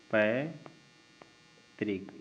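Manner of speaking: पैतृक stretched very slowly, पै drawn long; slow dictation. Shown as a demonstration. Teacher writing पैतृक on board, dictating slowly. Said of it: "पैतृक कैसे लिखा जाएगा?".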